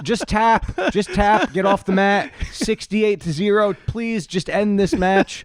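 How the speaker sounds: high-pitched imitation of an exasperated coach; mock pleading coach. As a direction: beg the student to give up, hammed-up. Voicing coach begging student to quit.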